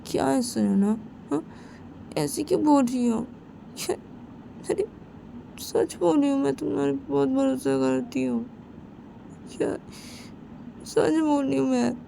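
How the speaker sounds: put-on high, anxious young girl insisting; girl voice pushed high, defensive; Simran's high voice.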